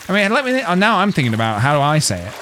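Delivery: usual speaking voice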